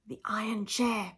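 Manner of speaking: a chilling hushed finish; ominous whisper. A narrator's reading. Narrator to listener, the iron chair as a dark whisper. Ominous end of torture list.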